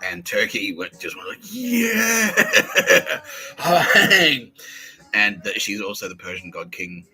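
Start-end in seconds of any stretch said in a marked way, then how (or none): 1.4-2.3 high, loud mock cheer; crowd cheering. Voicing all of Turkey reacting to the news, high loud cheer. 2.4-5.1 high, loud burst of laughter; loud laughing whoop. Real, not acted. After acting Turkey's reaction, loud high laughing whoop.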